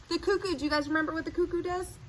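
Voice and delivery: plain reading voice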